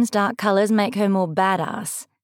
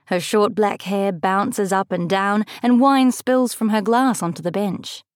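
plain reading voice

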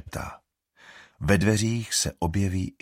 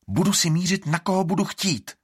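hissing; 11 semitones higher, 3 dB louder than usual. A narrator's reading. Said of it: "zasyčí Estrid".

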